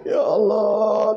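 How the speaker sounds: slow, drawn-out fake wail; long, theatrical mock lament. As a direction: ham up a grieving husband's cry. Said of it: "nangis bapak tuh".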